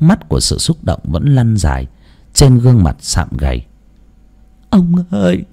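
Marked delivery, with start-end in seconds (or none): 4.7-5.4 high, wailing call to her late husband; old mother calling out in tears. Acted. Widow calling to dead husband about son's success, tearful high call. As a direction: cry out to the husband's spirit, overcome. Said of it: "nói trong vô thức".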